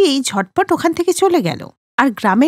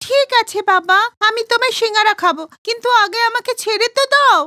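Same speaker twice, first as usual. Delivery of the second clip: woman giving in; 7 semitones higher than usual. Acted. Woman gives in.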